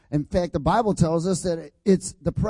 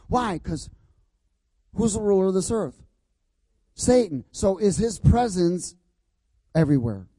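preaching; 3 semitones higher than usual. Real, not acted.

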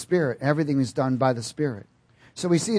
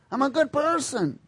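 higher, more muted smug repeat; mock good person; 10 semitones higher than usual. Mocking a self-righteous churchgoer.

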